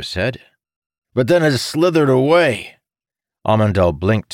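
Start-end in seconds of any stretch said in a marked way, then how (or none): 1.1-2.8 character voice. A narrator's reading. Necromancer continues explanation.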